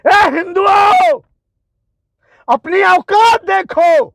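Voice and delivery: high, drawn-out shout; angry call-out. Real, not acted. Rebuking Hindus directly, angry drawn-out call. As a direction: call them out, stretching the words high. Calling out his opponents.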